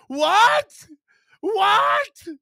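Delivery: high, loud disbelieving what; incredulous what. Real, not acted. Streamer reacting to a rival's take, loud disbelief. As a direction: shout it in utter disbelief.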